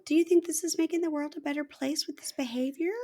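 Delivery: high, soft mock reproach; placating wife. Wife questioning husband's behavior, gentle mock reproach. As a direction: imitate her in a small, high voice. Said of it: "I think she was like".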